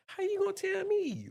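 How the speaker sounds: voice shooting up. Retold or imitated. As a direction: jump way up like caught in a lie. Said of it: "when we lying or we arguing with somebody".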